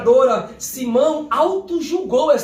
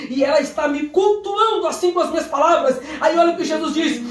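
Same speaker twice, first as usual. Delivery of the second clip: ordinary talk, nothing marked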